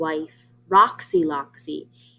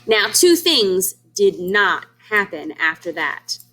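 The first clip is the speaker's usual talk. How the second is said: ordinary delivery, nothing marked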